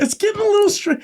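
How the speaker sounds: silly falsetto, cut off; high squeaky voice. Pretend. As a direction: squeak it, then break off. Repeats the joke, high voice.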